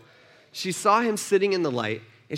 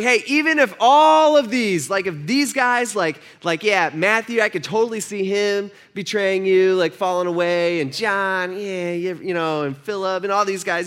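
boastful Peter; 2 semitones higher, 4 dB louder than usual. Acted. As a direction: puff up and brag. Peter brags over the others.

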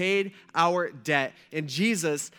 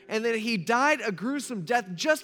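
passionate preaching; 6 semitones higher than usual. Acted. Preaching at full force.

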